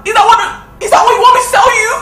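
rising furious shout; angry outburst. Acted. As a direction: throw the question back at her. Sister confronting angrily.

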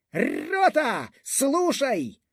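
high, ringing call to the company; officer's command. A narrator's reading. (командирський вигук високим голосом)

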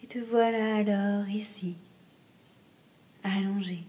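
slow Yoga Nidra voice, trailing into whisper; guided meditation. Acted. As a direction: ease the listener down with long pauses.

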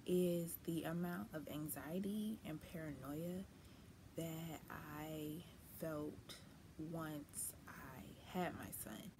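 ordinary delivery

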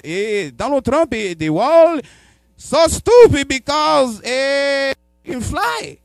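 high, loud exaggerated Spanish accent. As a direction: squeal it like an excited Spanish driver. Spanish Uber driver voiced.

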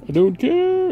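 sudden high, loud dismissive jab; defiant. Acted. Needling the rival leader, loud and defiant. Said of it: "I'm intentionally trying to piss him off now".

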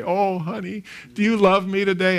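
lightly hammed-up pleading; needy wife. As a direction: imitate a wife seeking affection. Imagined wife phoning her husband at work.

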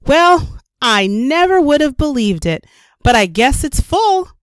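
high, delighted surprise; mother amazed at the full jar. A narrator's reading. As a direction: marvel that the jar is finally full.

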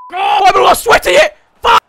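loud high yelling; yelling at the screen in excitement; excited shout. Real, not acted. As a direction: scream it at the screen. Shouting at goal.

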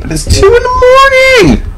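sudden very high squeal; outraged high squeal; squeaky outrage. Real, not acted. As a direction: jump way up into a squeak. Mock outrage at game.

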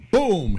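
loud high vocal gunshot; gunshot boom. Acted. Teller imitates the hunter's gun, loud vocal boom. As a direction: shout the shot like a cartoon blast.

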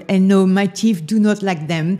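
usual speaking voice

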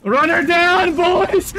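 loud laughing battle cry; rally the squad with a whoop; excited shout. Real, not acted. Rallying team to chase enemy.

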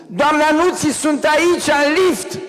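high, loud shout for help; calling out. Retold or imitated. Stuck in the lift, shouting to the dresser. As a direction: yell it from inside the lift.

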